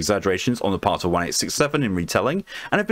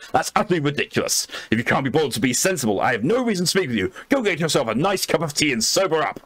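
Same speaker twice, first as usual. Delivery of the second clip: indignant Blackwood; 5 semitones higher than usual. Acted. Blackwood sends the doctor off for tea, huffy and higher. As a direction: dismiss the boy with offended dignity. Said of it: "I'm gonna have to do a voice for it".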